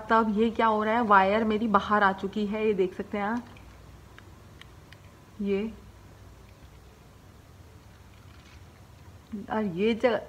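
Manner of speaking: lip held back by finger; speaking with finger at mouth; finger pulling lip aside. Real, not acted. Seen 4: Finger at mouth corner pulls lip back, showing braces, while speaking.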